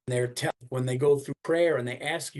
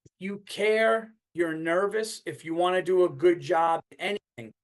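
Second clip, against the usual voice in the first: ordinary talk, nothing marked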